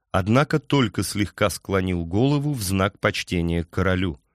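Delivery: ordinary delivery, nothing marked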